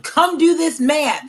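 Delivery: a replayed homework standoff, loud and slow; mock mom yell. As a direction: bark it out like a fed-up mom. Mom voicing homework quarrel with son.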